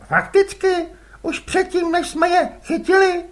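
put-on a boy asking innocently; curious boy. Les asks his father whose squid they are, boyish.

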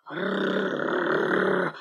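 long, low, soft rumble; dog growl. A narrator's reading. Shaggy dog warns the stammering puppy, low growl. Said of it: "зарычал пёс".

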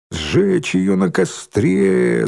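high, drawn-out cry; mock yell. A narrator's reading. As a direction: stretch it like a crowd chant. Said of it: "заорал Рыжий".

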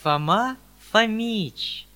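slow, grand announcement; announcing. A narrator's reading. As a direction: announce the arrival formally. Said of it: "возвестил Видоплясов".

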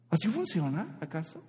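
normal talking voice

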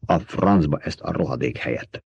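understated, winded cursing, softened; panting outburst. A narrator's reading. Coughing worker cursing the place, mildly winded outburst. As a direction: curse it low, short of breath. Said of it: "Aztán zihálva kifakadt".

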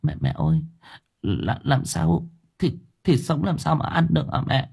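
soft, halting daughter-in-law; stammering. Acted. Daughter-in-law stammering at her terrifying mother-in-law. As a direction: stumble nervously over it. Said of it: "lắp bắp".

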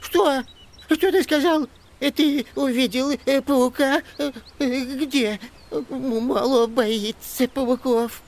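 high, panicky stammering elf; terrified. A narrator's reading. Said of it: "Закричал он в ужасе".

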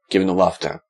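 ordinary delivery, nothing marked

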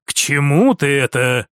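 low, guarded question; brother's reserved male voice; lightly voiced prince. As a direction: ask it low and wary. Prince questions sister's point.

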